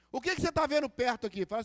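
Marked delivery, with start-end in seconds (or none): none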